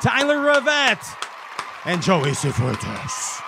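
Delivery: high, stretched MC call of the name; announcer call. Acted. Host hyping the fishing champions, announcer call. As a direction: boom the name like a ring announcer.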